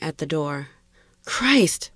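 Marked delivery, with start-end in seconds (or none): 1.2-1.9 startled hiss, pitched up; higher, alarmed exclamation; hissed oath. A narrator's reading. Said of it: "Brian hissed".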